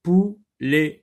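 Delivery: "Poulet" split pou... let; emphatic "pou", pause, "let"; syllable by syllable. Shown as a demonstration. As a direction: break "Poulet" into two separate syllables. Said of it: "dans la deuxième syllabe".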